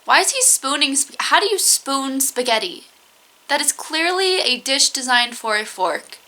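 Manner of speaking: usual speaking voice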